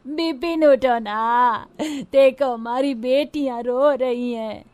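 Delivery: slow, drawn-out tearful plea; sobbing, begging him to wake; wailing widow. Acted. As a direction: wail in grief, stretching the words. Widow pleads with dead husband.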